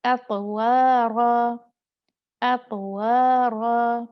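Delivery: slow loud "एतवारा" with a light flap; correct ra. Shown as a demonstration. Teacher repeating the correct "एतवारा" for the class. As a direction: hold each syllable, clean "रा". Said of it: "मैंने वहीं लगाई जहाँ पर इसका मखरज था।".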